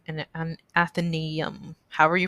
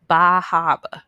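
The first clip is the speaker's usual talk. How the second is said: loud local New England accent, dropping the R's in "Bar Harbor"; attempted Bar Harbor local accent; 10 dB louder than usual. Shown as a demonstration. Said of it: "I can't say it with the accent".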